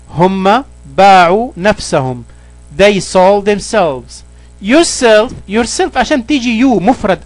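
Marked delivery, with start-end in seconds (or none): 2.8-4.2 slow, careful reading of the English example. Shown as a demonstration. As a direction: read each word slowly for the learner. (قراءة بطيئة وواضحة للمثال)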